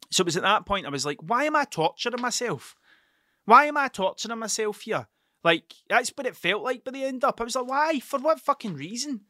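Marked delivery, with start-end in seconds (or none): 1.2-5.1 higher-pitched retold exasperation; exasperated self-questioning. Acts out his exasperated thought. 7.7-9.3 high, retold exasperated demand; exasperated questioning. Acts out his exasperated thought.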